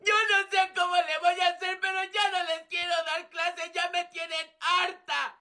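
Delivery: high, upset teacher; fed up. Acted. Teacher done teaching this class, fed up. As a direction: give up on them in a high, strained complaint.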